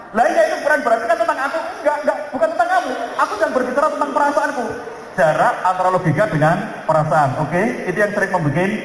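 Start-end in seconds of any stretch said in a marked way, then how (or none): 0.1-4.7 acted couple quarrel.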